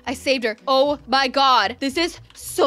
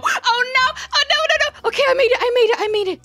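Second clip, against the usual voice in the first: high, loud frantic cries; panicked; 10 semitones higher, 3 dB louder, faster than usual. Real, not acted. Player barely getting away from the beast, panicked. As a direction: squeal in panic, then repeat it urgently.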